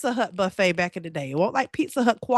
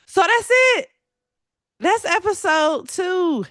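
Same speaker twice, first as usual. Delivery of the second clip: upbeat; 10 semitones higher, 4 dB louder, slower than usual. Real, not acted. Wrapping up the episode.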